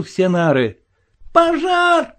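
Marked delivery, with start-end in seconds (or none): 1.3-2.1 very high, drawn-out alarm cry; unnatural scream. A narrator's reading. Boy raising a fire alarm, screaming wildly. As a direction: scream it in a voice not your own. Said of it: "закричал я не своим голосом".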